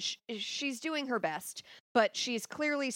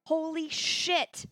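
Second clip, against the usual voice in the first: retold amazement; 7 semitones higher, much slower than usual. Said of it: "I was like".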